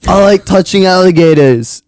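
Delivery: loud, high, slow Aussie imitation; Australian accent. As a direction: stretch out a hammed-up Australian accent. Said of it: "pretty good at accents".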